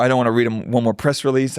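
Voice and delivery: usual speaking voice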